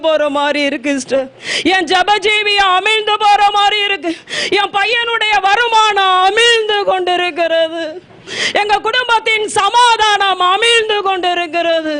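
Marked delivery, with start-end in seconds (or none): none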